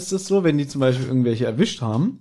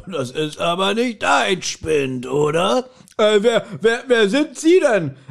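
gruff deep voice; 5 semitones higher, slower than usual. Retold or imitated. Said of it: "so eine bärbeißige, tiefe Stimme".

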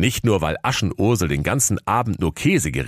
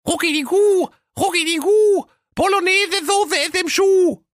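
tiny high-pitched chirping chant, swelling at line ends; twittering little cheeses; 3 dB louder than usual. A narrator's reading. Little cheeses warning the rider from the tree, shrieky high twitter. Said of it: "die im Baume saßen und zwitscherten".

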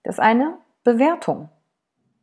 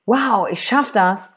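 confident self-talk; slower than usual. Shown as a demonstration. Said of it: "ob ich jetzt mir sage".

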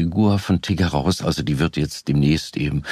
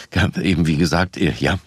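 ordinary talk, nothing marked

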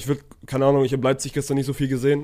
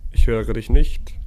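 loud and slow into the mic; speaking up slowly; 3 semitones lower, 5 dB louder, slower than usual. Real, not acted. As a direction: say it slowly and loudly so it gets through. Bad call connection, repeated.

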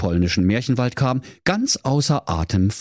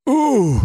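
mock groan; 5 dB louder than usual. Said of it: "rief der König Pilsner".

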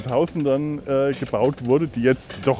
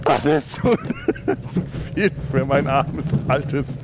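laughing. Real, not acted. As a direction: laugh while words spill. Laughing through the spiral.

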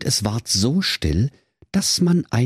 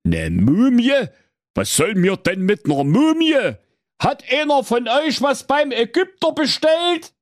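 hoher, empörter alter König; König Holzbrot; 3 dB louder than usual. Acted. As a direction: frech meckernder König. König spricht. (hohe Königsstimme)

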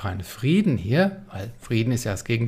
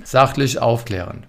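normal talking voice

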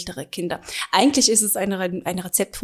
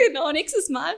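laughing; 8 semitones higher than usual. Real, not acted. Laughing along at Prosecco joke.

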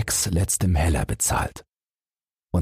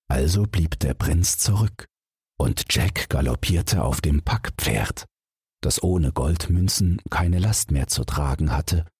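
ordinary delivery

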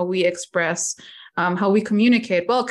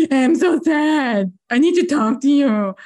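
mock sad plea; 5 semitones higher, 4 dB louder than usual. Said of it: "be like".

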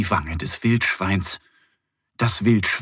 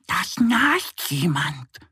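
high slow whisper; whispering duck; 7 semitones higher, slower than usual. Acted. Duck Annie whispers to Mo about the snoring. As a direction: breathe it out secretly, slow. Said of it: "flüstert Annie".

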